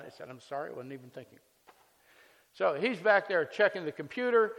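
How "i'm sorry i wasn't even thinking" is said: fast, softer mumbled apology; rushed apology. Retold or imitated. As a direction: blurt the excuse out quickly. Apologizing to officer.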